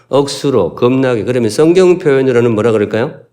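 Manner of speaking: ordinary talk, nothing marked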